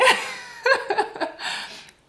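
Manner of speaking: high breathy laugh through emotion; teary laugh. Real, not acted. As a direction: laugh it off half in tears. Laughing while recalling crying.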